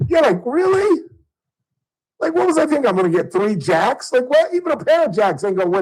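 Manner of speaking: high, loud disbelief at himself; incredulous. Real, not acted. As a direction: scoff at your own dumb move. Mocking his own bad poker bet.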